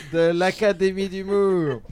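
starting to giggle through the words; giggling. Real, not acted. Host introducing his guests, giggling. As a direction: let a chuckle break into the phrase. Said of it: "Tu commences à glousser".

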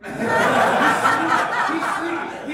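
웃음이 터져 말하는 소리; high, stretched words broken by laughter; laughing hard. Real, not acted. Laughing at sleepy uncle.

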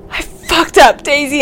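sobbing. Acted. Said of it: "burst into sobs".

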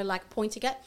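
ordinary talk, nothing marked

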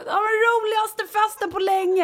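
very high, loud party squeal; hammed-up squeal of delighted partygoers; mock gushing. Tired mother mimics friends' texts from the party, mock-gushing.

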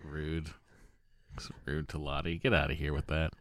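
very quiet, half-whispered scolding; low, soft aside of disapproval; hushed disapproval. Real, not acted. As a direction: scold under your breath, barely audible. Muttered reaction to email's jab.

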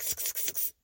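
quick hissed imitation of spray squirts; spray bottle squirts. Scolding a kitten with a spray bottle, hissed squirts. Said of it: "chain a little kitten with a spray bottle".

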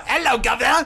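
lightly done British accent, Hello, governor said cheery; a hint of a Cockney British greeting. Shown as a demonstration. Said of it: "do your, uh, British".

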